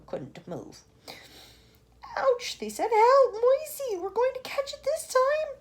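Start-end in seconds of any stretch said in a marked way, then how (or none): 2.2-5.6 high, loud panic; panicked. Acted. Travellers panicking at the cloud, high and loud. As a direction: yelp for help.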